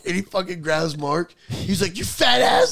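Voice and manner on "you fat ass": high, loud retold yell; losing it. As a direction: imitate an outraged friend yelling an insult. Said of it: "Keegan loses his mind".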